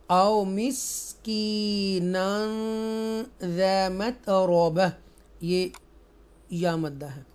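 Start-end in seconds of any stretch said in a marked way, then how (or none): 0.1-4.9 slow, drawn-out tajweed chant; Quran recitation. Shown as a demonstration. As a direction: recite each word slowly and melodically.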